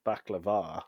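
echoing baklava as bak-la-VAH; emphasis on va. Retold or imitated. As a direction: say baklava with a long, stressed final VAH. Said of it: "Emphasis on the va there".

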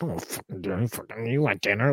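mock grumbling to himself; muttering under breath. Grumbling at an offer of dinner, muttered under breath. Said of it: "grimacing and muttering under your breath".

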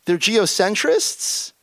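high, slow, baffled question; incredulous disbelief. Retold or imitated. Asking Tragen in disbelief, high and slow. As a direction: ask as if it can't be true. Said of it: "I take a look at this and I say".

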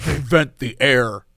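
low, drawn-out mocking quote; mock sales pitch. Mocking friend's shirt pitch.

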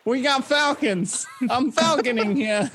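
hammed-up New York accent; a touch of New York tough guy; joking about Aberdeen locals in a mock New York accent. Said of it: "New York accent for everyone in Aberdeen".

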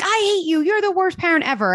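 high, mock bratty child voice; angry kid. Child told to go to bed yells at parent, high and bratty. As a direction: shriek it like a kid sent to bed. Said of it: "And they're like".

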